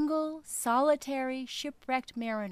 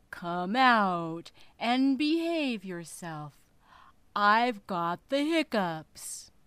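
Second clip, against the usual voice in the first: whale voice; 5 dB louder, slower than usual. Acted. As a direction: drag it out like a huge hungry whale. Whale calls down throat.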